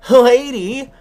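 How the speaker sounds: high, loud, theatrical reveal; hammed-up announcement. Fake cop revealing his job to the lady, hammed-up. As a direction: proclaim it grandly, pausing after the first word.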